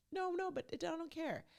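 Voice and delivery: high, whiny imitation of a clingy fiancée; mock whiny Chelsea. Mimicking needy Chelsea's protest.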